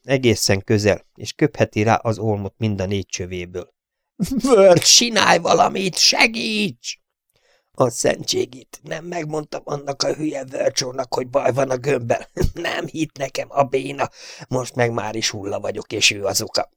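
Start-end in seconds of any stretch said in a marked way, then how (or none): 4.4-7.0 very high, slow, pleading scream; desperate plea. A narrator's reading. Trapped gunner begging Verge to do something, desperate. 7.8-16.7 soft, bitter muttering; bitter inner curse. A narrator's reading. Doomed gunner silently cursing Verge, bitter and soft.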